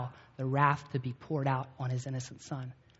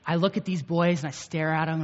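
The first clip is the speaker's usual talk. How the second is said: ordinary talk, nothing marked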